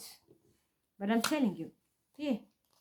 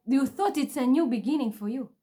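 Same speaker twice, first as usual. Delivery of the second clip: normal talking voice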